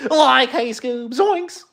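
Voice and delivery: high, loud cartoon stoner squeal; hammed-up spooked sidekick voice; cartoon Scooby-Doo sidekick. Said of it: "Can you do the Scooby-Doo voice".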